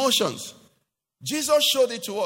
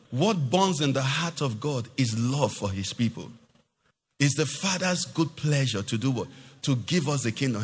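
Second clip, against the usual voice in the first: ordinary talk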